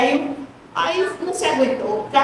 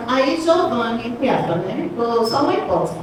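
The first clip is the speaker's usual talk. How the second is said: normal talking voice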